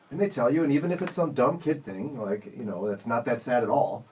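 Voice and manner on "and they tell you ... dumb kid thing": ordinary talk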